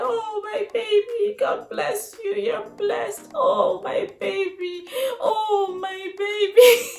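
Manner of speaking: high, loud, slow sobbing cry. Retold or imitated. New mother crying over her newborn on her chest, high and slow. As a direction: wail it through happy tears. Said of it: "I was just screaming".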